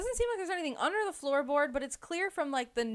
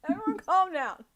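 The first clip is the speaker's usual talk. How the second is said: exasperated calming; 6 semitones higher, 7 dB louder than usual. Retold or imitated. Someone calming the scared girls, higher and slowed. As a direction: tell everyone to turn it down. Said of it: "It's like".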